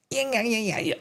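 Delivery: usual speaking voice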